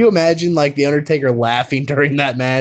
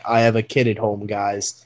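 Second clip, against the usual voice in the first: lower, softer, sheepish Ian quote; quoting Ian; 7 semitones lower, 6 dB quieter than usual. Retold or imitated. Retelling Ian's awkward line to friends, lower and softer. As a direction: mumble it like an awkward dad. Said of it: "He's like".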